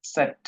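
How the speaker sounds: "সেট" pronounced tset, set apart; correct German Z. Shown as a demonstration. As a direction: model "সেট" with ts at the start. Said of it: "মানে সঠিক উচ্চারণ".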